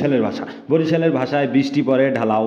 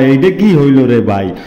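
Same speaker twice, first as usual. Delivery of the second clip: "এইডা কি হইল রে ভাই?" called out louder, stress on কি; louder quote; 8 dB louder than usual. Shown as a demonstration. Echoing what people say about his love, called out louder. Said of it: "এটা কয় মানসে কয়".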